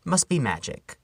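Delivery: ordinary delivery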